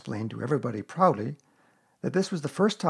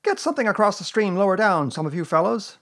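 raised, urgent call; Rabbit; 7 semitones higher, 5 dB louder than usual. A narrator's reading. Organising the rescue downstream, calling out. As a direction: call orders out to the others. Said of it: "called Rabbit".

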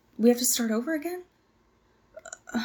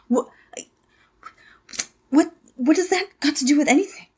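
higher and louder, in disbelief; indignant outburst; 6 semitones higher, 5 dB louder than usual. Acted. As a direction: push back, offended. Indignant at the interviewer.

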